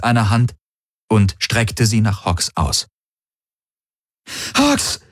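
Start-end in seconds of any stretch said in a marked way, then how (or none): 4.6-5.0 high-pitched panicked cry of a name; scream. A narrator's reading. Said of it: "schrie Kirai".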